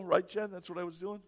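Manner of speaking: usual speaking voice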